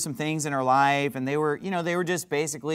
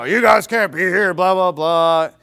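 louder put-on voice of the grumpy neighbor; imitated angry shout; 6 dB louder than usual. Retelling HOA man chasing ladies off, mock angry shout. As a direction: bark it out like the angry old guy. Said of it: "So basically, you know".

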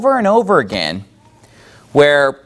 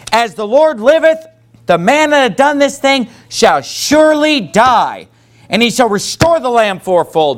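higher-pitched, understated anger, read aloud; angry declaration; 6 semitones higher than usual. A narrator's reading. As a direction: read out David's wrath with raised pitch and measured force. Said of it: "David's anger was greatly kindled".

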